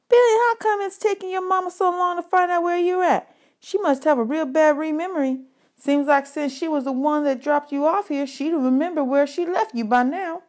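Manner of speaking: put-on high, teasing boy's voice lifted well above the narrator; mocking kid. A narrator's reading. Bug mocking the bully.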